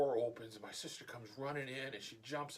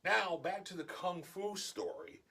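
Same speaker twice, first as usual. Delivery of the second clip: ordinary delivery, nothing marked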